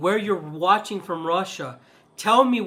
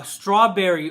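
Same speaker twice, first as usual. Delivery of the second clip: ordinary talk, nothing marked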